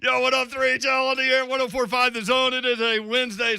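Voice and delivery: pumped-up DJ shout-out; hyped radio intro. Acted. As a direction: announce it like a hype DJ. Hyped on-air station intro.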